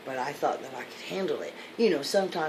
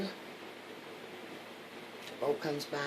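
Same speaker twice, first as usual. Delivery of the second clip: ordinary delivery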